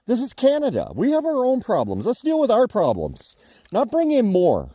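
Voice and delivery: high-pitched exasperated rant; rising indignation. Real, not acted. Heated rant about Canada.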